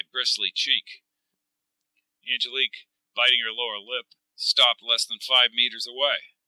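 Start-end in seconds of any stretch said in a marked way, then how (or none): none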